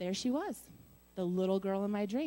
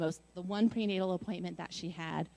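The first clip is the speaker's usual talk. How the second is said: ordinary talk, nothing marked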